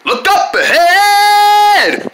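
high, long-drawn holler; calling a warning. Acted. Friend warning friend of danger ahead, high drawn-out shout. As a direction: stretch the last word into a warning call.

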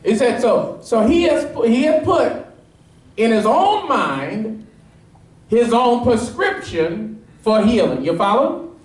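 high, ringing preacher's climb, pressing each point; preaching at full force. Acted. Pastor pressing the congregation on the leper's "If", fervent preaching. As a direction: drive the point home to the congregation.

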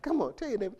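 ordinary delivery

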